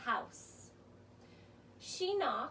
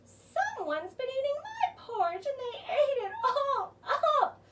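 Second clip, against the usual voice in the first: much higher, whiny tearful cub voice; crying baby bear; 9 semitones higher, 4 dB louder than usual. Acted. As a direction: wail it like a small upset cub. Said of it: "cried the baby bear".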